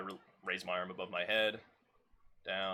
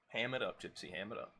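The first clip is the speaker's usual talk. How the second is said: ordinary talk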